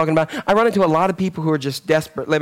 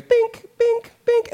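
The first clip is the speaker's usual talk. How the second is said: mock beep; much slower than usual. Imitating show's episode sound.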